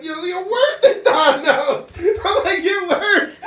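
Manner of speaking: mother laughing at her son; laughing accusation. Retold or imitated. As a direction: crack up while accusing him. Laughing at son's answer.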